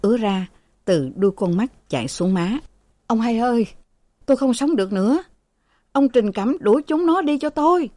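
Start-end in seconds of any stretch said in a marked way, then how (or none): 3.1-7.9 high, desperate plea; sick father begging the servant to chase them out; pleading. Acted. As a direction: beg for help, voice rising high. Dying father begs servant.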